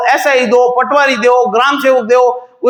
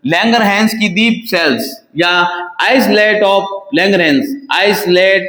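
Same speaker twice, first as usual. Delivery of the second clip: slow dictation; 3 semitones lower, much slower than usual. Shown as a demonstration. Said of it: "teacher spells out the term".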